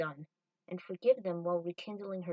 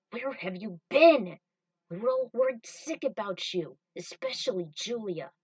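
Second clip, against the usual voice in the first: worried scolding; 9 dB louder than usual. Acted. Spike scolding Trudy after days of searching, loud and worried. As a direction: scold her out of worry.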